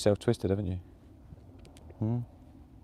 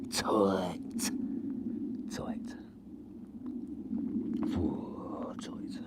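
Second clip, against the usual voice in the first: breathy whisper, each one fading; a playful, fading whispered echo; 3 semitones lower, 11 dB quieter, much slower than usual. Pretend. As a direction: echo it back in a whisper, dying away.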